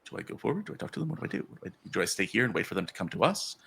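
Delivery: soft, quick thinking aloud; quietly second-guessing herself; unsure inner monologue. Acted. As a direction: run through nervous questions under your breath. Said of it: "she's trying to read the room of".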